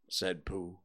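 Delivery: plain reading voice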